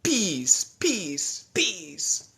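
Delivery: high, loud cartoon-hero voice; Goku. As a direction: play Goku beating the bad guys, high. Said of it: "As Goku would say".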